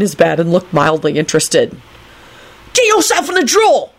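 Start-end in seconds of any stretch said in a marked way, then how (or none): 2.7-3.9 high-pitched, haughty order; arrogant, high-pitched boy; arrogant command. A narrator's reading. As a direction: order it about like you own it. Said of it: "Timothy told it arrogantly".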